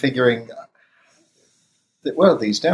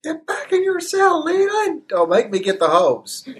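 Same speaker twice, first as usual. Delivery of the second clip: high yelling guard voice, much higher; prison guard. Acted. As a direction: bark orders at an inmate. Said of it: "I'm gonna scare the fucker back into his cell".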